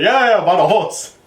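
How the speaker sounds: put-on Spanish accent. Voices the conductor, Spanish accent.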